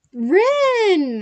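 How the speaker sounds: high, loud call across the room; bright teacher's call across the class; calling out a name. Retold or imitated. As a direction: call her name out cheerfully.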